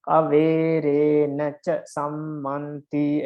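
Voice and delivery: very slow, each syllable held; drawn-out, evenly spaced syllables; syllable-by-syllable counting. Shown as a demonstration. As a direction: count out the verse line syllable by syllable. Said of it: "You can count them".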